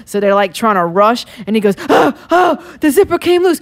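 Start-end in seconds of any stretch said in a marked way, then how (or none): 1.9-3.6 higher-pitched imitation of a flustered helper; mock alarm. Helper telling the mermaid about the zipper, mock alarm. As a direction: act out a sudden zipper panic.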